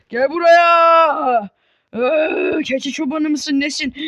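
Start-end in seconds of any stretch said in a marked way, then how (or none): 0.1-1.5 slow, louder, drawn-out yell; slow louder taunting yell. Real, not acted. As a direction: drag it out louder. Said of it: "repeat louder".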